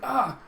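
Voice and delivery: short, high startled yelp; startled cry. Acted. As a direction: cry out in mock shock. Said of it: "Alita's just so surprised and shocked".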